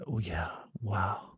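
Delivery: soft breathless whisper of wonder; awed whisper. Real, not acted. Teacher marveling to herself, whispering. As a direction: breathe it out in quiet awe. Awed pause in spiritual talk.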